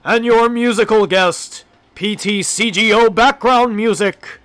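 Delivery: booming, drawn-out TV show announcer; Don Pardo announcer voice. Pretend. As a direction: belt it high and slow like a game-show host introducing the star. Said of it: "I'll hit my announcer voice, my Don Pardo".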